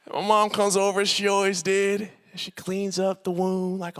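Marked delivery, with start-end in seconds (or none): none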